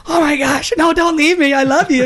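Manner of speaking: hammed-up plea not to be left; mock pleading husband. Retelling begging his wife not to leave, mock pleading. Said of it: "and I said".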